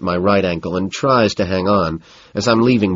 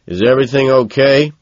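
security guard; 5 semitones higher, 4 dB louder than usual. A narrator's reading. Security guard questioning the two men.

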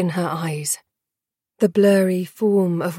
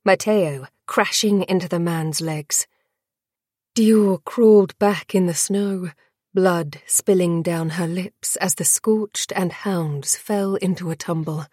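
ordinary delivery, nothing marked

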